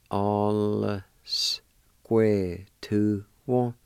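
"All square to one." drawn out very slowly; slow demo. Shown as a demonstration. Teacher modelling "All square to one." for students, very slow. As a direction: stretch every sound of "All square to one." for learners. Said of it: "pronounce the L sound with the A like this".

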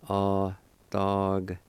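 "Adagtia" slowly, syllable by syllable; slow teaching demo of "Adagtia" with held vowels; drawn-out pronunciation. Shown as a demonstration. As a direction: stretch each syllable of "Adagtia". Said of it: "like this".

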